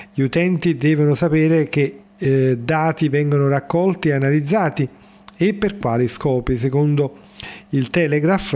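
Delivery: measured radio newsreader delivery; news read. Acted. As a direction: read it like a formal bulletin.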